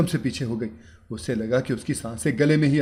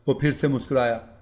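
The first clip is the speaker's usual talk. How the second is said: usual speaking voice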